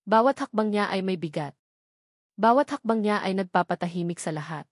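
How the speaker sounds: usual speaking voice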